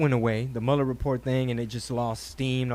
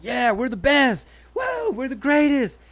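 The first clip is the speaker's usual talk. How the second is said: building to a loud, higher cheer; mock fan cheer; 12 semitones higher, 6 dB louder than usual. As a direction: gloat like a high school football crowd. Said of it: "They're like".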